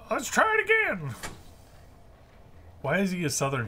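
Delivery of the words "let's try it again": lightly voiced high professor; half-hearted put-on professor voice; mock professor voice. Streamer trying the professor's voice again. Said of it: "I could do his voice really well".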